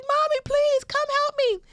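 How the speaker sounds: put-on high, loud, desperate child cry; child pleading. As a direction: beg for mommy like a scared little girl. Child dragged away by services.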